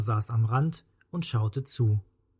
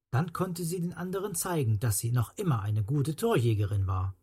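ordinary delivery, nothing marked

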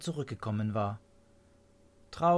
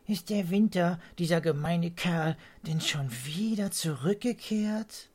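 groaning swallows; 6 semitones higher than usual. A narrator's reading. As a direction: complain like a fed-up little bird. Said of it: "Die Schwalben stöhnten".

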